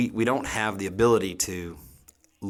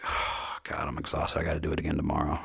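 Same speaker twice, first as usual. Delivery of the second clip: tired sigh; 3 semitones lower than usual. Retold or imitated. Mock tired cook voiced.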